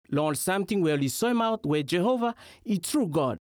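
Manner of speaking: normal talking voice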